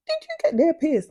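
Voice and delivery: high, sharp surprised mum voice; mock-shocked mum asking about the piercing; mum's surprised question. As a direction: imitate mum catching you out. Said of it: "my mum was like".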